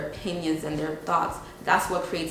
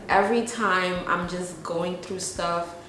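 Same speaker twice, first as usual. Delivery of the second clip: ordinary delivery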